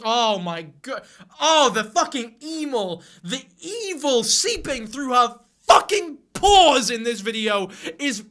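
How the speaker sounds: loud, high, drawn-out theatrical disgust; hammed-up outrage, stretching words and bursting out on the swear; exasperated mock outrage. Vlogger sarcastically condemning the cult leader, mock-outraged. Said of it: "I'm a little frustrated right now".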